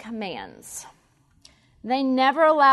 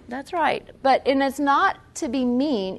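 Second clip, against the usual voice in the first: ordinary delivery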